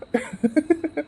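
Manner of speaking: high, soft disbelieving laugh; a surprised chuckle; amused laugh. Acted. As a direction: laugh off the idea. Husband laughs at her proposal.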